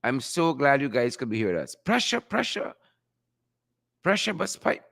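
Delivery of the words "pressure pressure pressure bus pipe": lightly put-on high voice; a hint of a character voice; Crab Man. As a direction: toss out the catchphrase higher, as an impression. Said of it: "in my best Crab Man voice".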